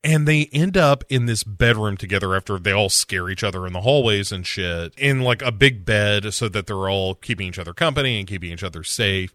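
normal talking voice

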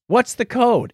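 ordinary talk

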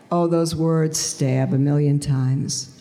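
ordinary talk

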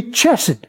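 chesed opening like English chess. Shown as a demonstration. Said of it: "it's not chesed".

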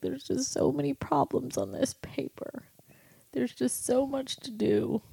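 put-on high whispered child's whimper; tearful child. Son overwhelmed by homework whimpers in a high whisper. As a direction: whisper it like an overwhelmed little boy. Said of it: "he was crying".

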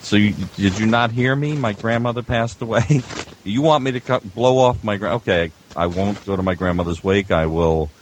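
ordinary talk, nothing marked

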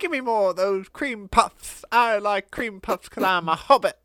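mock hobbit. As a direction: chirp it like a greedy hobbit. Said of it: "a Hobbit's sense of humor".

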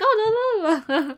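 high wordless mock babble; playful high-pitched prattle; mock chattering. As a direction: imitate someone rambling on obliviously. Mimicking someone chattering on.

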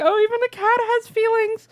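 very high, tender squeal; touched gushing. Retold or imitated. Viewer watching cat find her girl, touched. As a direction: coo it as a moved viewer.

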